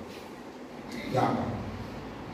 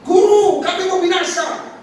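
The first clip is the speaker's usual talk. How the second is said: loud, very high desperate shout; panicked cry; 8 dB louder, slower than usual. Retold or imitated. Disciples to their Teacher in the storm, panicked high shout. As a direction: cry out for help at full voice. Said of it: "saya ngomong gini".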